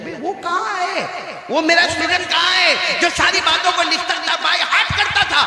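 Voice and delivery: high-pitched, calling-out question; impassioned preaching. Real, not acted. Teacher asking where his best student is, impassioned. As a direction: call out for the missing pupil.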